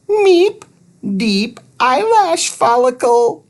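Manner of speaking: high beeping robot voice, broken grammar; robot Thud. A narrator's reading. Little robot explaining follicles, high beep first. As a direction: beep then explain simply.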